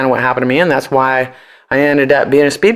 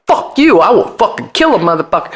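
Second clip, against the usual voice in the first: angry stage yell; 7 semitones higher than usual. Retold or imitated. Singer on the mic imitating her furious stage shout. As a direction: snarl it like a furious punk singer.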